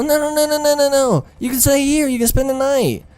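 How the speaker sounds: very high falsetto mock of a woman; pleading woman. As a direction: coax him to stay in a squeaky put-on voice. Imitates woman urging him to stay.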